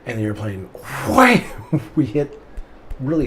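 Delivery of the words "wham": wham. Retold or imitated. Sound of the hard landing.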